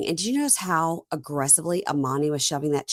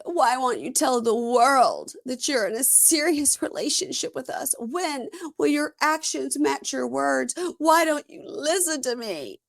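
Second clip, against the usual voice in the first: mock dramatic pleading; 7 semitones higher than usual. Mocking cast member's demands.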